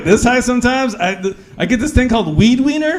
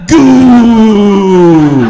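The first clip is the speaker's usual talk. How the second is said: loud, punched "Goo"; loud comic climax yelp; 11 dB louder than usual. Retold or imitated. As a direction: blurt a silly climax cry. Her orgasm noise.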